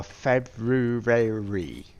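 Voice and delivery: slow Plymouth "February" stretched to "Feb-roo-ray-ree". Shown as a demonstration. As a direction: drag out "February" in a broad Plymouth accent. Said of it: "where I come from, Plymouth".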